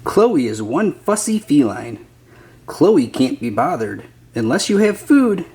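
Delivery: plain reading voice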